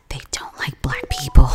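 a hushed, conspiratorial warning; whispered secret. Retold or imitated. Boy on plane warns her.

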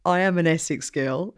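normal talking voice

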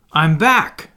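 loud, high, heated announcement; indignant, forceful call; hot-tempered. A narrator's reading. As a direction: announce it hotly. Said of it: "he said hotly".

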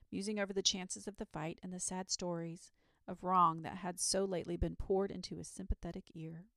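usual speaking voice

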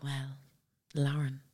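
lightly voiced doctor, soft and hushed; a hint of a grave doctor breaking news softly; mimicking the doctor. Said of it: "I'm pure mimicking him".

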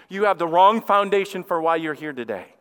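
normal talking voice

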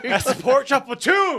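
loud, high triumphant shout. Acted. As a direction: yell it out proudly.

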